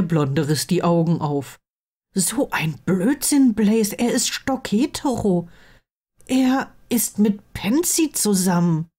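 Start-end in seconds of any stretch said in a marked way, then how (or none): 2.1-8.9 higher-pitched, stunned protest; disbelieving best friend. Acted. Blond friend hearing an unthinkable name, disbelieving. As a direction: reject it as nonsense in a high voice.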